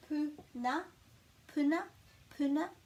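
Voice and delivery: "Puna" with a short, quick u. Shown as a demonstration. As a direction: say "Puna" crisply with a short oo, lifting at the end. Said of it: "it's a short u sound".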